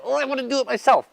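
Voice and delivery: high mocking whine. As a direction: mimic a know-it-all, high and fast. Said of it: "some people will see that and be like".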